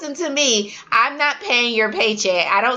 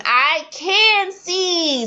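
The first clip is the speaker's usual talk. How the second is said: high, very slow model answer; teacher modeling the answer; 7 semitones higher, much slower than usual. Shown as a demonstration. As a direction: spell it out slowly. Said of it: "same, same".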